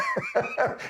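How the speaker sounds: a sudden high squeal of a laugh; high burst of laughter. Real, not acted. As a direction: crack up high at the tiny shirt. Laughing at the tiny shirt.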